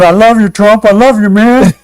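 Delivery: fawning, high mock adoration; mocking sycophant. Host mocks Tim Scott gushing at Trump, fawning high voice. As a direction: gush at Trump like a lapdog. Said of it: "He's the guy that said".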